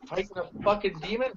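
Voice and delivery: quick, lightly put-on Irish accent; mock Irish accent. Player answering where they have been, in a mock Irish accent. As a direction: throw in a sudden hammed-up Irish lilt. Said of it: "I don't know why I went Irish".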